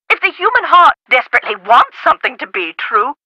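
ordinary talk

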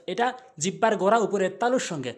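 ordinary delivery

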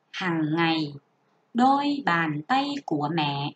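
careful classroom reading; model reading aloud. Shown as a demonstration. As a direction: read it as an example to copy. Teacher models reading paragraph one.

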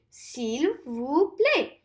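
"S'il vous plaît" very slow, word by word; slow repeat-after-me. Shown as a demonstration. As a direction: lead the class through "S'il vous plaît". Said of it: "Try saying it with me".

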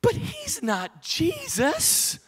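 slow, high-pitched teasing aside; joking aside. Real, not acted. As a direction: draw it out slowly, up high. Same joke, now about Chase.

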